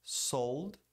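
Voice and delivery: "sold" with a clear L; touch the palate for L in "sold"; correct L. Shown as a demonstration. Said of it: "A pronúncia correta aqui é".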